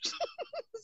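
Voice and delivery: a high, breathless burst of laughter. Real, not acted. Blogger laughing at her own joke, high squeal of laughter.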